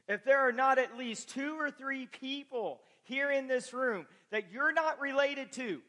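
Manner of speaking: fervent sermon voice, driving each phrase home; impassioned preaching. Acted. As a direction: preach it with growing conviction, pitch climbing. Preaches with rising force.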